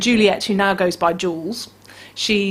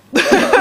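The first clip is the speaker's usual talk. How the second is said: high burst of laughter; 7 dB louder than usual. Real, not acted. Laughs at joke.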